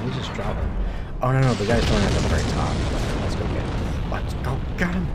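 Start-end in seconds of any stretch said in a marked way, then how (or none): 3.2-4.1 breathy whispered mutter; whispering. Acted. Repeated line, whispered first. 4.1-5.1 high, goofy cartoon voice; silly high voice. Repeated line, high silly take.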